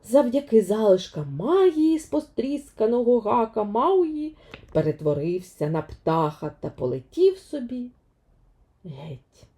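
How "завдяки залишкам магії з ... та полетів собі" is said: usual speaking voice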